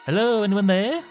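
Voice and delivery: loud, high call into the hall. Acted. Calling into silent hall.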